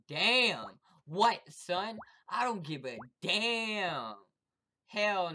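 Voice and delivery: shouted, defiant taunts; loud trash talk. Real, not acted. Gamer shouting defiance at other players, loud taunts. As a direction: bellow it at your rival.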